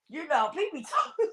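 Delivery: laughing through the words; amused laugh mid-sentence. Real, not acted. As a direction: breaks into real laughter. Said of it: "I'm laughing".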